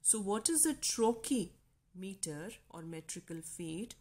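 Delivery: usual speaking voice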